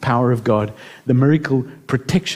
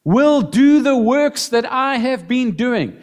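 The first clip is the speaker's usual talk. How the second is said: slow, much higher, emphatic; quoting scripture; 8 semitones higher, slower than usual. Retold or imitated. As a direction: lift and slow the promise. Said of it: "look at these words".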